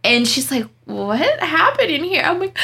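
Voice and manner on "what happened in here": retold higher-pitched shocked question; mock a bewildered coworker asking.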